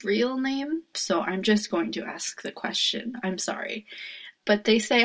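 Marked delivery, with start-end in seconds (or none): 0.9-3.9 a voiceless, very soft "sorry"; whispered apology. Real, not acted. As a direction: whisper the apology under your breath. Apologizing for the name.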